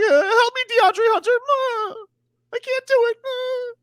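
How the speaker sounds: very high, whimpering mock cry; mocking a player begging a teammate for help, in a high whimpering cry; whiny big baby. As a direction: whine like a helpless crying child. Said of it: "Big baby".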